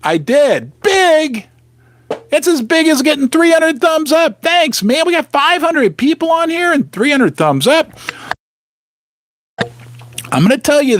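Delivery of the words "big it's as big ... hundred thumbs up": much higher, gushing playful hype; hammed-up excitement. Real, not acted. Streamer thanking viewers for three hundred thumbs up, hammed-up excitement. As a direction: pitch it way up and celebrate.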